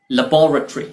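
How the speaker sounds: लेबोरेटरी as "luh-BAW-ruh-tree"; British लेबोरेटरी, stressed on "BAW"; UK pronunciation. Shown as a demonstration. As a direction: stress the second syllable of लेबोरेटरी, end in "tree". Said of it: "UK pronunciation के लिहाज से".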